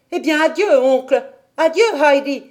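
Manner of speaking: loud, high, hurried farewell; hasty goodbye. A narrator's reading. Dete hurriedly says goodbye before running off, loud and high. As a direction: blurt it out and flee. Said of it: "dit-elle rapidement".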